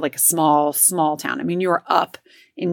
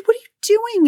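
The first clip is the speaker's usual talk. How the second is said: imitating startled kid; 3 dB louder than usual. Woken brother startled.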